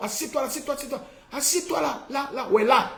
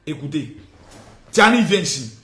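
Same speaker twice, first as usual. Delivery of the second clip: ordinary talk, nothing marked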